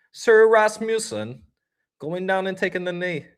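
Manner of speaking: mock British knight announcement, English accent; hint of an English accent. Joking about a player kneeling, light English accent. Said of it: "I'm not very good with accents".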